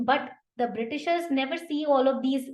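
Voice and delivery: ordinary talk, nothing marked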